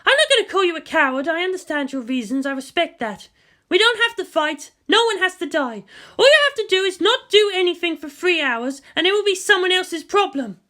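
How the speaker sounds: lightly voiced determined commander, urgent; a grim officer pushing through pain; Misato, tense. Narrator voicing the wounded commander to the leaders, urgent.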